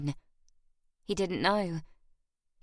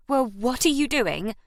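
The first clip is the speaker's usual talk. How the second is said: higher and louder, caught off guard; startled protest; 4 semitones higher, 4 dB louder than usual. Acted. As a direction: jolt awake and demand an answer. Her eyes flew open.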